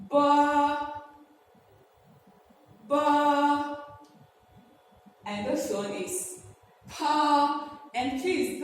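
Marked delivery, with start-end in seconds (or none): 0.1-3.8 ba loud and high, both lips; bilabial ba. Shown as a demonstration. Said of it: "So it goes this way". 6.9-7.8 pa loud with a strong puff of air; aspirated pa said loudly as a model. Shown as a demonstration. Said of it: "this is an aspirated sound".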